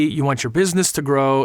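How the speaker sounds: ordinary talk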